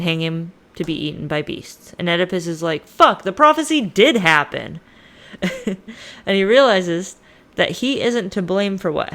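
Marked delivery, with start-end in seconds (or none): 2.9-4.8 loud, high outburst of dismay; hammed-up shock, voice jumping up and louder; mock horrified realization. Oedipus learns the prophecy came true, mock horror. 5.3-6.2 soft, high little chuckle; brief giggle to yourself; short laugh. Real, not acted. Storyteller amused by own retelling, soft chuckle.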